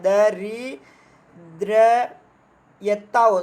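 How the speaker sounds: दरिद्रयता drawn out syllable by syllable; very slow spelled-out word. Shown as a demonstration. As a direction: dictate दरिद्रयता very slowly. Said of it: "दरिद्रता होता है".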